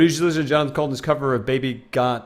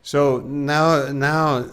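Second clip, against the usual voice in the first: normal talking voice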